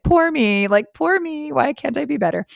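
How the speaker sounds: high, whiny poor-me voice, a little mocking; mock self-pity. Mocking herself to listeners, whiny self-pity voice. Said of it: "this is the whole".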